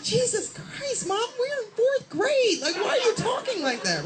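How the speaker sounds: put-on very high, squealed kid voice; mortified child's protest. Fourth grader to swearing mother, mortified high protest. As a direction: plead in a high, stretched-out child voice.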